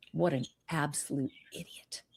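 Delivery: hushed, breathy aside; whispered aside. Real, not acted. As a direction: whisper it like a secret. Aside insult to listeners.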